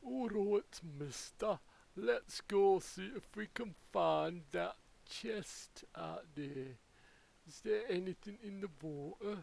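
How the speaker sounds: hammed-up vague British regional accent. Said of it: "I'm not sure what accent that was".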